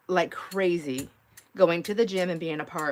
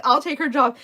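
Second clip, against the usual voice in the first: eager quote; 8 semitones higher, 9 dB louder than usual. Retold or imitated. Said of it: "Joanne's like".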